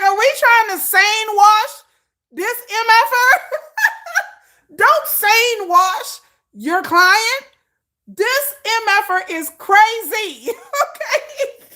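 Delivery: high, loud, slow, laughing through it; cracking up in disbelief. Real, not acted. Friend laughing at sane-washing assistants, loud and high. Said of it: "I like".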